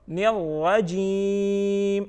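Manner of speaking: very slow chant, last vowel held long; model recitation. Shown as a demonstration. Teacher models the fourth part for children, slow chant. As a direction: draw the final syllable out evenly.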